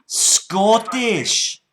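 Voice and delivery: put-on loud, slow, high Scottish accent; put-on Scots, drawn out and loud; broad Scottish accent. Prank caller to scammer, broad Scottish.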